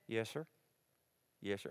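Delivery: low, soft Alabama "yes, sir"; Alabama good old boy. Retold or imitated. Alabama welder answering questions by the fire, polite Southern accent. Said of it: "he talked like this".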